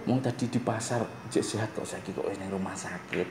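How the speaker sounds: soft hospital voice. Retold or imitated. Teacher shows a visitor's hushed talk in hospital. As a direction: speak low beside a sickbed. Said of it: "Tapi ngomongnya piye?".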